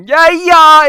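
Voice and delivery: loud, high mock shout; shouting yeah. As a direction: yell it right in their ear. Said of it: "someone screaming at you".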